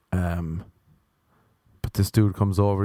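ordinary talk, nothing marked

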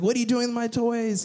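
high-pitched mock toddler protest; playfully hammed-up child's complaint; toddler freaking out. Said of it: "he's freaking out".

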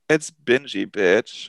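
slow drawn-out announcement; slow show intro; segment announcer. Acted.

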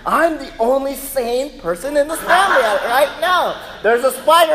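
loud, high-pitched comic exasperation; exasperated dad. Retold or imitated. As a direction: protest like the one sane person in chaos. Exasperated dad amid family panic.

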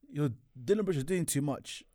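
normal talking voice

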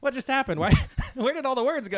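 high, loud, baffled outburst; a baffled yelp; mock surprise. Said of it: "and you're like".

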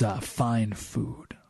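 slow breathy whisper of fine food. Acted. Narrator savoring the rat's love of food, slow whisper. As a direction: savor the words in a hushed whisper.

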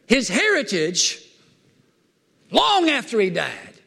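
high-pitched preacher in full flow, stretching the key words; fired-up preaching. Real, not acted. Preacher asking the congregation about David's blessed heritage, fired-up preaching. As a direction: preach it high, drawing out the big phrases.

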